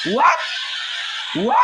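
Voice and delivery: high breathless disbelief; shocked. Real, not acted. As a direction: gasp out a stunned what. Surprise in game.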